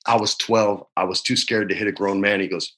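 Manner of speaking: normal talking voice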